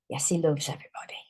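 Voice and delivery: quick hushed aside about the dog; fast whisper. Real, not acted. Teacher whispering to viewers about her excited dog. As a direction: whisper it fast, then back to the lesson. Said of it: "aside about the dog".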